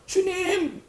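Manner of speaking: lifted, pleading call of Lord; high plea. Shown as a demonstration. Preacher voices the plea. (높이 올린 간절한 부름)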